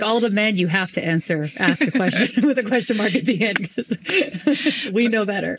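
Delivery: laughing through a playful mock of the stern professor. Retelling the college professor's rant, laughing mock voice. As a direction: poke fun at her line, giggling. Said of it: "She's like".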